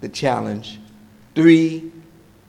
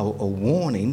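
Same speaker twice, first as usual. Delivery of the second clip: ordinary talk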